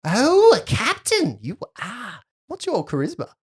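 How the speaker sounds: haughty, swooping high "Oh"; mocking little lady. Girl to self-styled captain, mocking.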